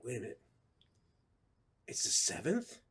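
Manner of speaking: hushed, puzzled self-talk; dawning confusion. Retold or imitated. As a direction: work it out quietly, pausing midway. Retelling puzzled dawning realization.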